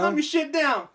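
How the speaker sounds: high, loud angry yell; loud, startled shout. Retold or imitated. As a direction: snap at him across the room.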